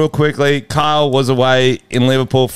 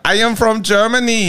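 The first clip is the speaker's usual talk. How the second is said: higher-pitched mock German accent; 7 semitones higher than usual. Shown as a demonstration. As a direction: put on a rough German accent. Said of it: "Just do a German accent now".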